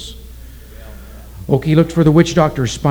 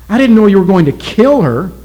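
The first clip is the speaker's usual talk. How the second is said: higher, louder anguished cry; anguished protest; 7 semitones higher, 8 dB louder than usual. A narrator's reading. Oki crying out to the witch doctor, higher and louder. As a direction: protest in shock and grief.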